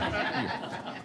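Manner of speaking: brief airy chuckle; a quiet embarrassed laugh; soft real chuckle. Real, not acted. Laughs at own slip.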